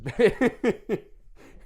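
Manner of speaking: loud high laugh bursting out; high burst of laughter. Real, not acted. Cracking up at the condom joke, loud laugh. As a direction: crack up loudly.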